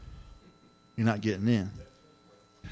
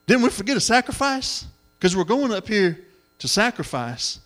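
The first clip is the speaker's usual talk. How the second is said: put-on young Isaac asking; 8 semitones higher, 5 dB louder than usual. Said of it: "He says".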